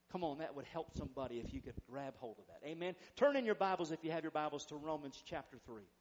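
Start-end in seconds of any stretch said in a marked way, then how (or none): none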